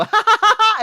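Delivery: put-on high, loud child's cackle; imitated kid laugh. Kids laughing at their own swearing, mock cackle. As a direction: cackle like a delighted naughty kid. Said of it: "they would go".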